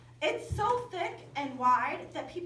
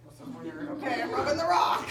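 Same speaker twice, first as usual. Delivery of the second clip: giggling while saying it; laughing through it; 7 dB louder than usual. Retold or imitated. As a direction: laugh as you admit the odd task. Laughing at odd instruction.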